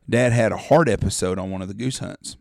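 normal talking voice

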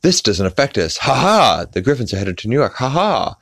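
hammed-up cartoon exclamations, rushed between laughs; Speed Racer characters. Speed Racer characters reacting to the Griffins' trip, mock cartoon bravado. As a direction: imitate cartoon heroes with high, punched "ha ha". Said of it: "and they're like".

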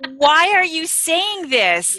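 scolding self. Retold or imitated. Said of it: "I'm like".

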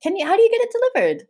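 high, fast, rushed question; surprised. Real, not acted. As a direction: blurt it out in surprise.

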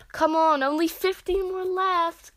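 a drill coach's yell, held back in volume; acted yell. A narrator's reading. Katsuki urging on push-ups, drawn-out high yell. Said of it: "Katsuki yelled at me".

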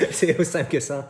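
laughing high and loud. Real, not acted. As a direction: laugh through the words. Laughing at own joke.

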